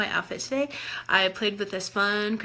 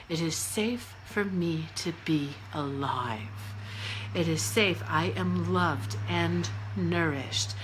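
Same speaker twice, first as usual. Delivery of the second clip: tender affirmation; 5 semitones lower, 6 dB quieter, slower than usual. Acted. Tender self-affirmation for eating disorder.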